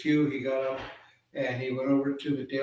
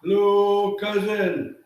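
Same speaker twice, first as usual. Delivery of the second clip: loud, high hail to a cousin; raised, carrying storyteller's call; 7 semitones higher, 7 dB louder, slower than usual. Acted. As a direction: sing out a greeting at the door. Raven calls at Seagull's house.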